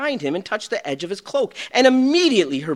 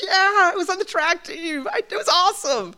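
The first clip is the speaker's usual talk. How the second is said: put-on higher, thrilled teen voice; girl's proud answer; 12 semitones higher than usual. Girl telling the stewardess, a hint of proud teen. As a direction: beam about the jacket. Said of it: "She goes".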